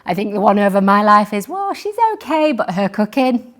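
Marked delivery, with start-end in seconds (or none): 1.5-3.5 hesitant faint praise. Retold or imitated. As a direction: imitate someone tactfully disapproving. Mimicking a critic of her cooking.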